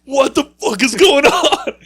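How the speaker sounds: high, loud, slow outburst breaking into a laugh; shocked shout. Retold or imitated. Seeing her skate partner about to fight a lady, a shocked shout. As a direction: blurt it out in disbelief.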